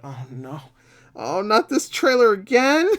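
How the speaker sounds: high, drawn-out mock despair; loud, slow wail of complaint; exasperated groaning. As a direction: whine it out, hammed-up and fed up. Retold dread at an overplayed trailer.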